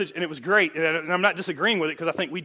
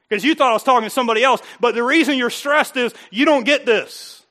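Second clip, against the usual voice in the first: preaching at full force; 4 semitones higher, 7 dB louder than usual. Acted. Preaching hard to congregation.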